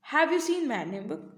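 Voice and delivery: slow question leaning on YOU; stress on YOU. Shown as a demonstration. Teacher demonstrating how stress changes meaning, stress on YOU. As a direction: put the weight on you, early in the question. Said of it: "repeated with different words".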